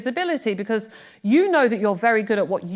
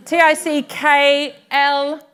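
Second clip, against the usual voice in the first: spelled out; 4 semitones higher, 6 dB louder, much slower than usual. Shown as a demonstration. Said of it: "spelling out acronym".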